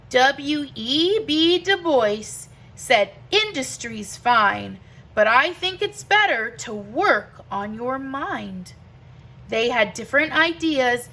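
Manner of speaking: rhythmic rhyming read-aloud; reading to children. Acted. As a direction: read it like a bedtime picture book, lilting. Reads picture book aloud.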